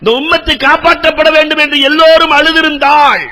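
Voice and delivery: high, loud pleading sermon cry; fervent preaching. Acted. Preacher urging the congregation to pray and weep, impassioned high preaching cry. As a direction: urge the crowd to weep in prayer.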